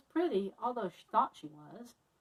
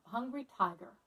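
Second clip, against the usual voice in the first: ordinary delivery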